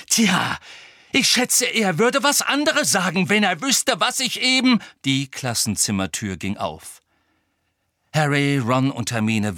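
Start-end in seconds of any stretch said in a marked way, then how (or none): 0.1-4.8 high, heated retort; angry comeback. A narrator's reading. Harry retorting to Hermine about Sirius, angry. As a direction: fire back sarcastically. Harry snaps back in quarrel.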